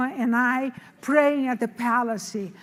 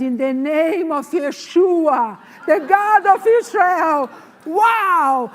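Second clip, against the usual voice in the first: loud, ringing declaration in the name of God; bold proclamation; 6 dB louder, slower than usual. Retold or imitated. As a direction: proclaim it with force. Retells proclaiming God's name boldly.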